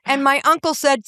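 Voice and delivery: ordinary talk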